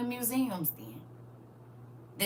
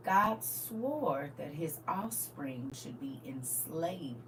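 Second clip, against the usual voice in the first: slow, softer grave whisper; 6 semitones lower, 11 dB quieter, slower than usual. Real, not acted. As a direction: lean in and whisper the curse slowly. Reading grim verse hushed.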